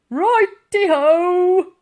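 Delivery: high, loud, slow daft call; a dim constable's chirpy shout; cheery shout. A narrator's reading. Constable shouting cheerfully up the ladder, slow loud. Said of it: "shouted up".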